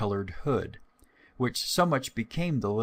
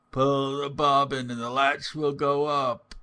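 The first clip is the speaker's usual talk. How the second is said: a touch softer put-on old woman call; wolf faking grandmother's call; wolf softening his voice; 4 semitones higher, 4 dB louder than usual. Wolf to the girl, mock grandmother voice. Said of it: "cried out in a softer voice".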